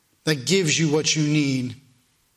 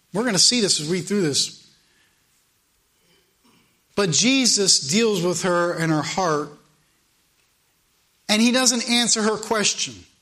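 usual speaking voice

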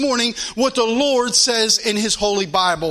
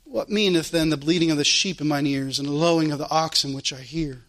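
much lower-pitched, understated verse reading; subdued scripture quotation; 6 semitones lower than usual. Real, not acted. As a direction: let the verse land low and knowing. Repeats verse low after phone story.

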